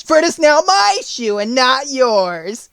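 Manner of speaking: loud, high witch voice; loud gloating witch; wicked witch retort. A narrator's reading. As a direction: crow it defiantly as a wicked witch. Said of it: "retorted the witch".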